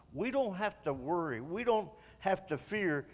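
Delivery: ordinary delivery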